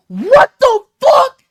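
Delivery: high, loud, drawn-out disbelief; shocked outburst. Retold or imitated. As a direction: reenact a stunned yell, stretching each word. Retelling own stunned outburst.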